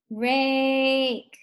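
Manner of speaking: RAKE on one long held vowel; said slowly. Shown as a demonstration. Teacher helping pupils hear it, RAKE said slowly. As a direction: draw out RAKE so the A is heard. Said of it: "Say it slowly".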